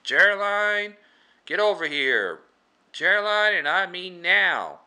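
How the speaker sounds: high, loud imitation of an impatient summons; mock bossy holler; calling out sharply. Lady summons Geraldine, demanding she come.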